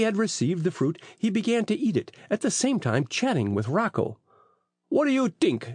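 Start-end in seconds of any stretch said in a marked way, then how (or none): 4.9-5.7 put-on high Italian-accented voice, lightly snickering; lightly mock in an Italian accent; mild snicker. A narrator's reading. Italian fruit seller scoffing as he chats with Phil, mild snicker. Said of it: "snickered the Italian".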